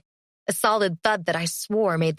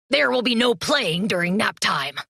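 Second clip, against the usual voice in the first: strict tone. A narrator's reading. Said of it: "Groton said in a strict tone".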